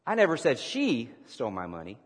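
"she" punched, brief pause after it; stress on she. Shown as a demonstration. Preacher practicing emphasis with the congregation, stress on "she". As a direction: hit the word "she". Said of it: "depending on the emphasis that you place".

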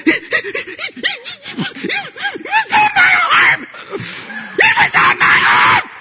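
high, sobbing little-girl shriek, hammed up; tearful gasping child. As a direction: imitate a hysterical daughter wailing through gasps. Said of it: "with tears in her eyes and, and, and gasping for breath".

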